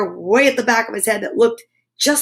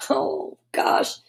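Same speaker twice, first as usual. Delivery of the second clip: whispered, much lower, no voice; dismayed whisper; 6 dB quieter than usual. Acted. Family whispering in dismay over the stuck brush. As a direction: breathe out the dismay.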